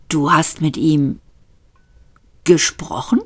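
ordinary delivery, nothing marked

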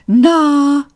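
high, sugary lift voice; honeyed. A narrator's reading. Lift coaxes its passenger, honey-sweet. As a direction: let it ooze like honey. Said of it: "rieselte die Stimme weiter wie Honig".

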